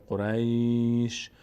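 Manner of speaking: "قریش" with a long "ay" and bare final sh; stopped, vowelless ending. Shown as a demonstration. Teacher showing two sukuns at a stop, drawn-out ending. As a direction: stretch "قریش" slowly, closing on sh alone. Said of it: "وقتی ساکن بکنیم قریش رو".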